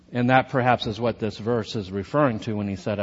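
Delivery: ordinary talk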